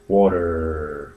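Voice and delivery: American accent, r-coloured "water" said low and soft; "water" with an American r at the end. Shown as a demonstration. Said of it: "as the British say".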